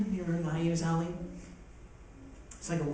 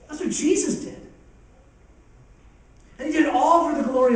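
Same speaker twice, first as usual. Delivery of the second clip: louder, higher, building preacher's cadence; rising preaching; 6 semitones higher, 4 dB louder than usual. Acted. Preacher rousing the congregation about Jesus healing the sick: building, fervent preaching. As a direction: preach it home with growing fire.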